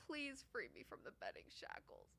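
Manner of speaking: soft, low pleading; quiet defeated plea. Real, not acted. Bettor quietly begging chat to free them from betting. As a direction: beg softly, spent.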